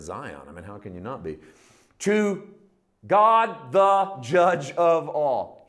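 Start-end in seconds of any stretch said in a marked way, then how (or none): 2.0-5.6 loud and high, slowly stretched out; booming proclamation. Real, not acted. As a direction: thunder it out from the pulpit. Preacher proclaiming to congregation.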